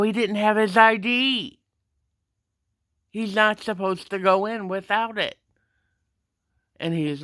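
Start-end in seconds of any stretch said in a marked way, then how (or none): 0.0-5.4 higher, raised voice arguing back; retold indignant protest. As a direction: reenact an exasperated objection to the guard.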